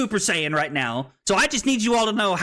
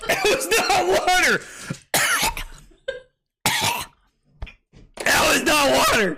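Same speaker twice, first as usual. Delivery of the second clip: coughing outburst; 10 semitones higher than usual. Real, not acted. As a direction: sputter it out, stunned it wasn't water. Choking on strong drink.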